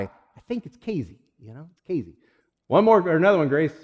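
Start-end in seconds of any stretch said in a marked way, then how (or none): 0.0-2.2 CASEY with a Z, KAY-zee, soft; local pronunciation. Retold or imitated. Settling it by the radio station's letters, Casey with a Z. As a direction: say Casey softly as Kayzee. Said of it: "W K Z I".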